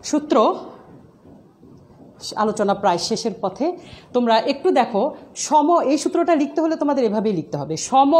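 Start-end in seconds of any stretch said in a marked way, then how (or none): none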